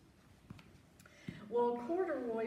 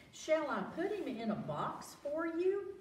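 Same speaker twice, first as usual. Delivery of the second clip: higher polite saleswoman voice; sales lady; 8 semitones higher, 3 dB louder than usual. A narrator's reading. Sales lady offering to box the bear, lightly voiced. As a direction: offer it sweetly. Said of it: "the sales lady asked".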